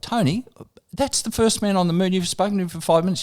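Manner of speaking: high, pressing plea to the host; urgent protest. Retold or imitated. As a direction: protest through the buzzer. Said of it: "through the buzzer".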